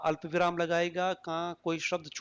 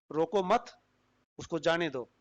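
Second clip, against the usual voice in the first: रोको मत rising, break, then उसको जाने दो; pause after मत. Shown as a demonstration. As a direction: comma placed after मत. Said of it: "अल्प विराम मत के बाद".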